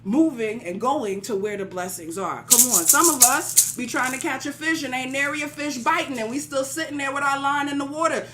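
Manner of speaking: rising, rhythmic preaching with call-outs; a pastor rallying the congregation. Acted. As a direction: preach it and build to the peak lines.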